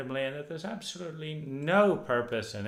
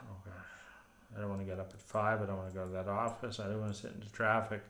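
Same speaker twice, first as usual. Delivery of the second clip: weary grumble; 3 semitones lower, 7 dB quieter, faster than usual. Retold or imitated. Dreading the early commute.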